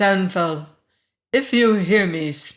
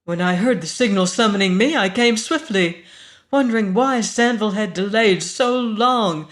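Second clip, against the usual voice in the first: loud, ringing, commanding woman; 3 semitones higher, 7 dB louder than usual. A narrator's reading. Woman accuses stranger and demands Sanfel, ringing and insistent. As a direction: let the accusation and plea ring out with urgency. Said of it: "The voice rang like a bell".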